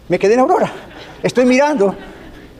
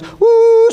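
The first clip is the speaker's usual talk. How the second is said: long, high hooted "uh"; sustained high-pitched whistle call; train whistle imitation; 4 dB louder than usual. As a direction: hoot like a steam train whistle. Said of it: "suena el silbato".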